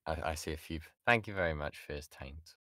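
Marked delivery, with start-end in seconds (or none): none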